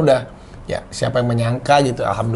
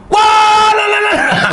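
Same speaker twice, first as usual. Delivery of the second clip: much higher, louder laughing shout; mock outburst; 7 dB louder, much slower than usual. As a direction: burst out as if catching him, laughing. Acting partner's blow-up after confession.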